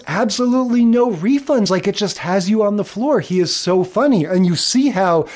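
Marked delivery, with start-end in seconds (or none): 0.1-1.7 higher-pitched quote of the sitcom dad; Willie. Retold or imitated. Podcast host repeating the dad's dumb random line, lightly imitated, higher. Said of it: "the way he says".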